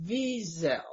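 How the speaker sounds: Wiesel with a V and stress on the first syllable, VEE-zel; proper pronunciation. Shown as a demonstration. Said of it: "The, the proper way".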